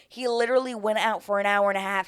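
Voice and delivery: ordinary talk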